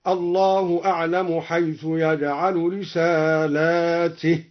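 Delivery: رسالاته read with a long alif, plural; slow recitation of رسالاته. Shown as a demonstration. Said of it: "بالجمع على تعدد الرسالات هكذا".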